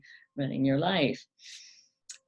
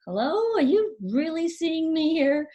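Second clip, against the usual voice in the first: high-pitched, incredulous hello; mock attention-seeking; 11 semitones higher, 4 dB louder than usual. Unseen person to a narcissist looking through them, mock incredulous and high. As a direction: call out as if checking anyone's there, pitched way up. Acted plea to inattentive listener.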